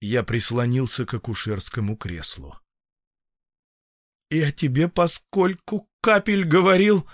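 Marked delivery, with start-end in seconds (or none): 4.3-7.1 high, strained disbelief; strangled voice. A narrator's reading. Doctor questioning patient about the dose, strangled. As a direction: squeeze the question out, pitched high. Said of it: "задушенным голосом заговорил я".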